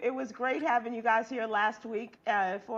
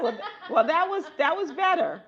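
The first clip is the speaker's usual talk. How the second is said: words through laughter, higher and louder; laughing; 6 semitones higher, 11 dB louder than usual. Real, not acted. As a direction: laugh while you answer. Host laughing at the joke.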